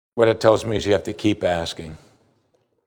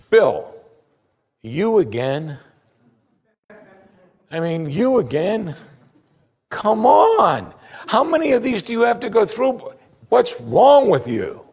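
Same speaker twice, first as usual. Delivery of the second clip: mock exasperation; 9 semitones higher than usual. God imagined scolding Bill for asking yet again, mock exasperated. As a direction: play an exasperated scold fed up with repeat requests.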